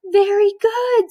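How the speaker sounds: high, loud delighted gasp then praise; delighted teacher. A narrator's reading. Teacher reading, overjoyed her student counted right. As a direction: gasp with joy at his progress.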